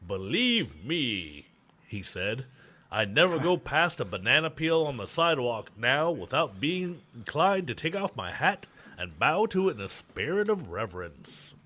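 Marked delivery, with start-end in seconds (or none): 0.1-1.5 put-on slow, drawn-out old-time gentleman; old-timey voice. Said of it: "I'll try to do my old-timey voice". 2.9-11.5 put-on higher-pitched old-time gentleman voice; lightly voiced old vaudeville showman; old-timey voice. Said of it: "I'll try to do my old-timey voice".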